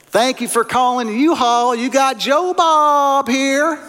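thick East Tennessee drawl, high; hammy East Tennessee phone greeting; East Tennessee accent. Retold or imitated. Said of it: "in a very thick East Tennessee accent".

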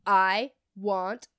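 loud, slow, word by word; imitating an insistent, irate customer; angry customer demand. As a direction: hammer out each word at half speed. Retold customer's angry demand at counter.

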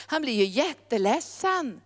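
normal talking voice

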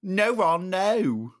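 high-pitched, pleading British voice; British accent. Acted. Harry stopping Ron in the play, high British protest. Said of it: "what you thought of our accents".

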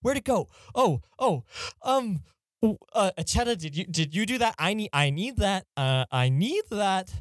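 high, frantic searching voice; panicking over a lost scroll; panicked. Acted. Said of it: "kind of having a panic attack right now".

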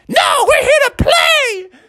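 very high, slow squealed shout; high-pitched baby battle cry; insistent baby frog. Acted. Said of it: "the baby was like".

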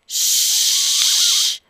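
long hushing shh; shushing. Acted. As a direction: hush them quickly. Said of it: "whispered Bob".

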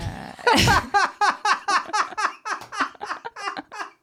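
high, loud burst of laughter. Real, not acted.

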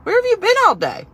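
high, loud mock coworker. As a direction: play the annoyed coworkers. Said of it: "people are like".

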